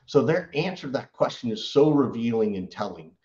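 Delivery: ordinary talk, nothing marked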